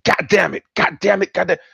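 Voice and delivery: a driver's repeated curse, acted out; cursing driver. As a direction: imitate a driver swearing in rapid bursts. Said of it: "she'd be saying".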